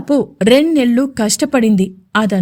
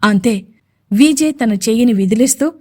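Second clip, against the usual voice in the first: ordinary delivery, nothing marked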